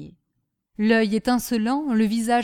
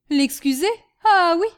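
loud, higher incredulous scoff; 7 semitones higher, 7 dB louder than usual. Acted. Husband answering wife about the cook, scoffing. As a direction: throw the question back in mild outrage. Master scoffs at wife's question.

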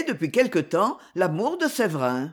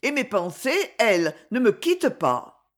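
usual speaking voice

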